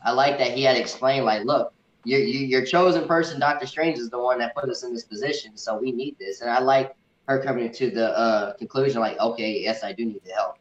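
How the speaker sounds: ordinary talk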